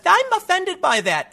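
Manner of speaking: high, slow, prissy mock voice of the offended woman. The heckler tells the comedian she's offended, in a high mocking voice. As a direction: put on a high, prim, wounded tone.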